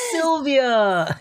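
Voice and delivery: high, drawn-out imitated cry of a name; high falling wail, hammed-up; mock scolding call. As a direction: call the name out in comic reproach. Acting out character's exclamation for laughs.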